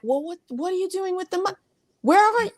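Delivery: high, rushed, incredulous questioning; acted-out flustered reproach; mock alarm. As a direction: demand answers in a high, fast voice. Voicing alarmed loved one about money.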